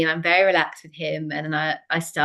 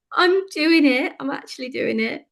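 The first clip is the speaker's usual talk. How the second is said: slow, much higher, louder shout of triumph; retold triumphant scream; 7 semitones higher, 4 dB louder than usual. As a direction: stretch it out like you can't believe it. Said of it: "scream to him on the video".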